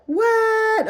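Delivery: squealed high, loud what; astonished. Real, not acted. Teacher shocked at her own little toe, loud high what. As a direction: genuine shock, held high.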